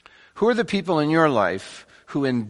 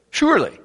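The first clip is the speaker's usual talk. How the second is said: "Surely" thrown out high with real punch; emphatic SURELY; 10 semitones higher, 4 dB louder, faster than usual. Shown as a demonstration. Preacher showing the congregation the psalmist's certainty, emphatic "Surely". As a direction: hit "Surely" hard, pitched well up. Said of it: "he gets emphatic about it".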